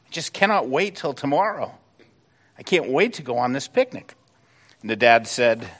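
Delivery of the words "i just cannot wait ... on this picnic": a lightly voiced eager little boy, pitched higher; a hint of a breathless child begging his dad; excited little boy. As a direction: play the kid who can't sleep for excitement. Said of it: "little boy said".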